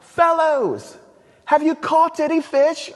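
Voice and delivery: high, loud Monty Python British voice; hammed-up posh British Jesus; British accent. Jesus calling to the fishermen from the beach in a British accent. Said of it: "maybe Jesus had a British accent".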